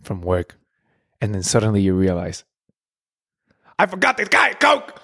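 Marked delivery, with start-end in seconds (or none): 3.8-5.0 much higher, alarmed I-forgot; mock panicked realization. Server remembering a forgotten Coke on the way home, high panic. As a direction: it hits you all at once, high.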